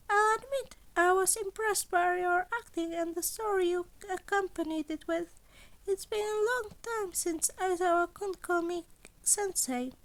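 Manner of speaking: playful, teasing, eager to hear; high chirpy principal. Acted. Nezu on the phone.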